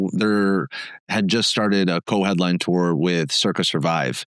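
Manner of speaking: normal talking voice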